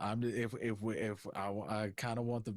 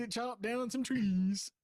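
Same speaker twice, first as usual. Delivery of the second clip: high, louder put-on Viking voice; 11 semitones higher, 3 dB louder, slower than usual. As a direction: ham up a Viking warrior. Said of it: "you have to talk in a Viking voice".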